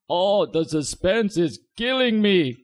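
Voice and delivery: slow, high, loud vampire drama; Count voice. Retold or imitated. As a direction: drag it out like the Count. Count's catchphrase.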